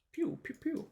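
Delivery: squeaky laser pew sounds; high pew-pew noises. Pretend. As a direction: mimic spells firing, high and tiny. Imitates spell sounds.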